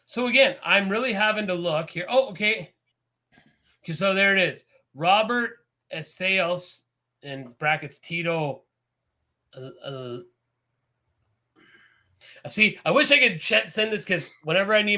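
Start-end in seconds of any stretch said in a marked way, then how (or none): none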